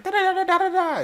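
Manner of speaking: very high rapid gibberish scolding; mock scolding gibberish. Aunt telling the man off, high rapid gibberish. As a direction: rattle it off like an angry aunt. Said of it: "really fast talking".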